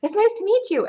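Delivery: high, loud, bright greeting; excited high greeting. Retold or imitated. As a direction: squeal a quick hello. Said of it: "to say".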